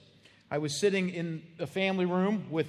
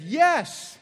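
high, loud mock wife voice from the kitchen; lightly hammed-up wife shouting; wife calling back; 6 semitones higher, 7 dB louder than usual. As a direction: call back cheerfully from the other room. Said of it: "His wife said".

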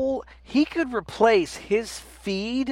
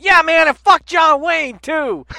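loud, high, slow hype-man shout; hype friend cheering; 9 semitones higher, 8 dB louder, slower than usual. Retold or imitated. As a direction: hype the crowd like [name]. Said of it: "he would just come in and be like".

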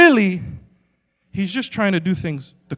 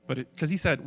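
ordinary talk

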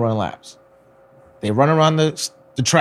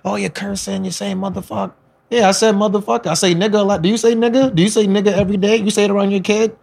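mock heated argument; 7 semitones higher than usual. Quarrel acted out.

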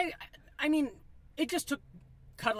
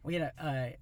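usual speaking voice

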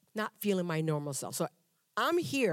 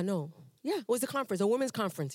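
ordinary delivery

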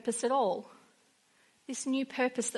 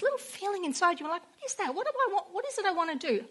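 much higher, quick wondering questions; high, quick wondering at a feeling you can't name; puzzled inner voice; 8 semitones higher, faster than usual. Retold or imitated. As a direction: voice your own confused inner thoughts.

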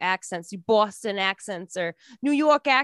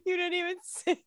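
high, soft laughing words; 8 semitones higher, 7 dB quieter than usual. Real, not acted. Co-host laughing at his non-singing rat role, laughing. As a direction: squeeze the words out through laughter.

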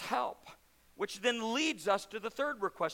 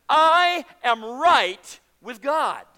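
slow, loud, drawn-out declaration, much louder; triumphant proclaiming shout; 4 semitones higher, 13 dB louder, much slower than usual. Acted. Preacher to his congregation, a slow triumphant shout. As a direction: ring it out to the back row.

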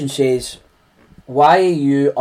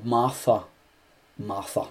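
soft, lower and tender; gentle reply; 5 semitones lower, 9 dB quieter than usual. Acted. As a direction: say her name softly, calming her. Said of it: "He replies to her saying".

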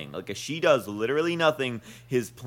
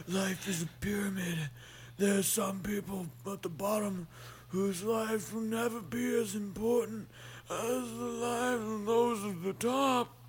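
villain voice; 6 semitones higher, 9 dB quieter than usual. Retold or imitated. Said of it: "He goes like".

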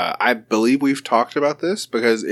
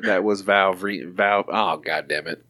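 ordinary delivery

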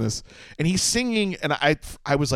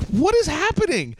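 high, slow, baffled question; bewildered; slower than usual. Retold or imitated. Retelling his confusion at rude Salt Lake clerks, baffled high. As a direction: sound utterly lost and confused.

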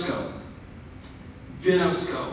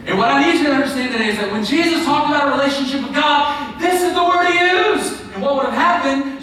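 loud, high, climbing pulpit delivery; forceful preaching; 8 semitones higher, 7 dB louder than usual. Real, not acted. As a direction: drive it to an emphatic peak.

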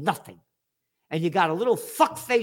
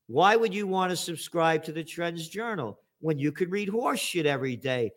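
ordinary talk, nothing marked

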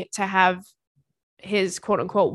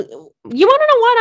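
much higher and louder, a sudden squeal; exasperated outburst; 9 dB louder than usual. Real, not acted. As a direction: blurt it out, flustered. Embarrassed outburst, then a joke.

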